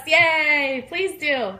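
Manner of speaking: ordinary delivery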